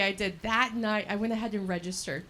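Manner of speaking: usual speaking voice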